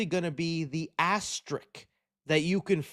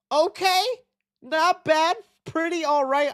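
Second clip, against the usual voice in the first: surprised approval; 10 semitones higher than usual. Real, not acted. Reacting to limo bucket reveal.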